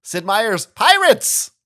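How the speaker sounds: loud exclaimed Sid Meier's Pirates!; exclaimed title. Shown as a demonstration. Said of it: "'Cause there's an exclamation point in it".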